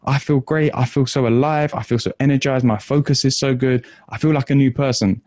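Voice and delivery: high, gushing, thrilled student bursting in; mock scream of pure delight; excited student. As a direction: rush in overjoyed, voice pitched high.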